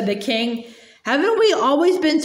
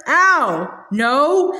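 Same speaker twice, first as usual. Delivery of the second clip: bellowing. Acted. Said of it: "bellowed Trumpkin".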